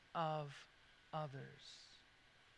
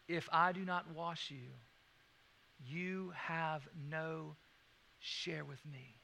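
softer and slower to a hush; tender, slow; 4 semitones lower, 6 dB quieter, slower than usual. Retold or imitated. Jesus answering the proud believer, tenderly and softly. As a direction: let it fall gently to a whisper.

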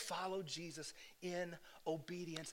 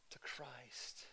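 whisper; 5 semitones lower, 11 dB quieter than usual. Acted. Whispered ending.

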